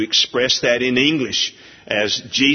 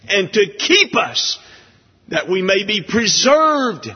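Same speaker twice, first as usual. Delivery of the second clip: higher, louder pulpit voice, slowing on key words; fervent preaching; 10 semitones higher, 4 dB louder than usual. Acted. Preacher exalting God's love to congregation, impassioned preaching. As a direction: lift it up and proclaim love to the pews.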